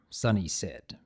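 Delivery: ordinary delivery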